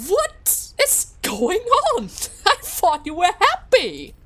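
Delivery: slow, high start, then loud protest; exasperated outburst. Real, not acted. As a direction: exclaim in baffled, playful exasperation. Exasperated at bickering family.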